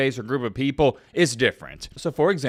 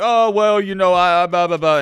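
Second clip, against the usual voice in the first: louder flustered stammer; mock flustered reporter; 4 semitones higher, 5 dB louder than usual. Vlogger mimics the reporter making excuses, flustered. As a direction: fumble for excuses. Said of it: "the reporter's like".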